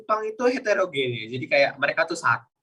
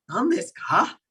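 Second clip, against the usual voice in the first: "Nan desu ka?" with "ka" lifted slightly; questioning tune, "ka" going up; raised question ending; 3 semitones higher, slower than usual. Shown as a demonstration. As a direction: lift the last syllable "ka" of "Nan desu ka?". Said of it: "terakhirnya agak dinaikin".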